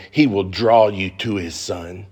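ordinary talk

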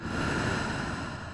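long, soft breath out; slow exhale. Shown as a demonstration. Guide showing listeners how to exhale slowly, soft sigh. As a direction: demonstrate a slow sigh out through the mouth. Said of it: "exhale slowly once more".

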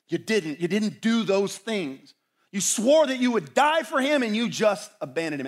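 rising, forceful self-reproach; accusing inner voice. Acted. Shaming voices in Peter's head condemn his desertion, mounting accusation. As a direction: build to a loud, high accusation. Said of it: "those are the voices that whisper in our head".

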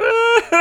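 squeaky high little laugh; high giggle. Retold or imitated. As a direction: giggle like a tiny toddler. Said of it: "is just looking at it going".

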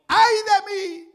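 slow, high, loud cry of woe; Isaiah's lament. Acted. Isaiah crying out before God's holiness, slow anguished wail. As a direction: wail it like a broken prophet. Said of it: "ahí cambia la mente de Isaías".

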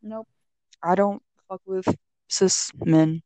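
normal talking voice

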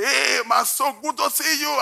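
high, sing-song greeting; mock excited yell; sugar-high yelling. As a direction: greet like you're buzzing on sugar. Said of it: "I was yelling".

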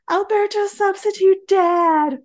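high, loud, slow overwhelmed cry; hammed-up tearful gush; emotional gush. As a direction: gush it with big feelings. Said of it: "he's like".

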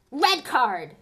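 furious. A narrator's reading. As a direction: snap it back hotly. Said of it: "said Dean furiously".

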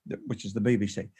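normal talking voice

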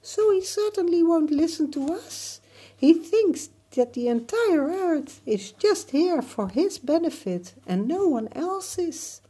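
very high, loud tiny voice; ladybug voice. Acted. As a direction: squeak like a tiny insect. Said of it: "a ladybug said in insect talk".

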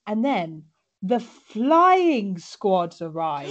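slow, drawn-out dramatic announcement; dramatic reveal. Acted. Building up the rescue team, slow dramatic reveal. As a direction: drag out the flying squad.

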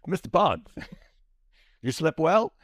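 laughing mid-line, loud; Walken through laughter. Acted. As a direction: break up while doing Walken. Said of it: "channel my Walken".

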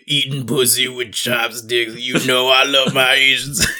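slow, lightly voiced rapper flow; imitating rapper's delivery. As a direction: drag it out like the rapper would. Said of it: "I can imagine it being".

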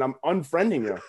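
ordinary delivery

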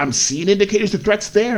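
alarmed inner voice. Retold or imitated.

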